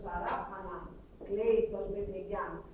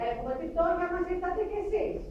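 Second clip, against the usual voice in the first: retold annoyed complaint, pitched much higher; resentful villagers; 8 semitones higher than usual. Poor villagers resent arriving refugees, mock annoyed complaint. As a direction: complain sharply about the newcomers. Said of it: "θυμώνουνε".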